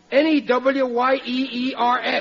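N E W Y E E R S spelled letter by letter; impatient spelling down the phone. Acted. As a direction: spell out N E W Y E E R S, one letter at a time. Spelling words over the phone.

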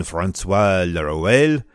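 François Laruelle in a slow, hammed-up French accent; terrible French accent. Shown as a demonstration. Host admits to listeners, exaggerated French accent. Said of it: "that's my terrible French accent".

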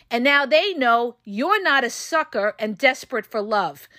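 ordinary delivery

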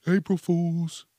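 slow, mocking drawn-out April Fools; sarcastic April Fools. Mocking the soap pranksters, slow sarcastic April Fools. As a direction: sneer the words out slowly.

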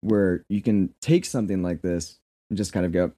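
ordinary talk, nothing marked

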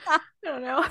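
laughing. Real, not acted. Laughing uncertainly.

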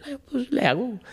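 a high, timid "I'll manage"; mock dad voice. Father's reply retold.

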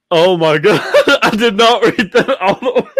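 words rising high into laughter; real laughing fit, pitch climbing; laughing in disbelief. Real, not acted. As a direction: blurt it out while cracking up. Mortified, laughing at own slip.

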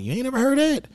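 high-pitched disbelief; mock-shocked comeback; incredulous question. Retells disbelief at his date's answer.

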